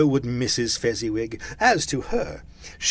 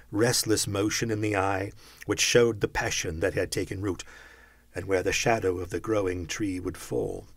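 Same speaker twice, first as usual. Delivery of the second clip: usual speaking voice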